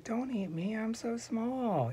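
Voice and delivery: high, drawn-out mock-pitiful plea; pleading little goat. Small goat pleads for its life.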